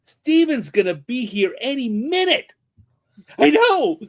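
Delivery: high, loud flustered panic; panicked. Retold or imitated. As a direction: scramble before he arrives. Panicked girlfriends.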